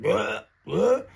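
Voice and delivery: high gagging blehs; imitated retching. Man recounting inhaling pepper spray, imitated gagging. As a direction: gag on the spray. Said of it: "I'm still inhaling all that spray".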